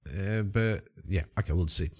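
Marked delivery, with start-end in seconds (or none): none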